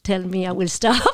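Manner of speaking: usual speaking voice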